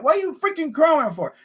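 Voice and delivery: ordinary delivery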